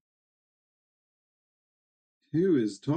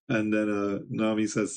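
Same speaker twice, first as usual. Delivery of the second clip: ordinary talk, nothing marked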